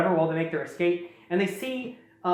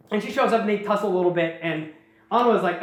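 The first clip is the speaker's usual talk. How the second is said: ordinary delivery, nothing marked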